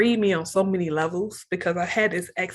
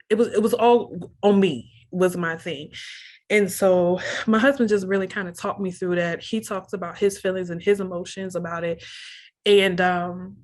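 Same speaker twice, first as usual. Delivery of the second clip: ordinary delivery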